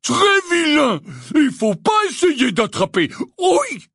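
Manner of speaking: high loud reprimand; scolding shout. Acted. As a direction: shout the rule at him. Hagrid scolding after being hit.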